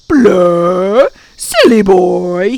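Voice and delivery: loud, slow creature jeer; taunting gaboon. A narrator's reading. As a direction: jeer it with tongue stuck out. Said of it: "sticking out his tongue".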